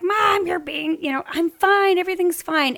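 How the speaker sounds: imitating the calm daughter, high and airy; daughter brushing it off. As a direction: wave off mom's worry, lightly. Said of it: "and she's like".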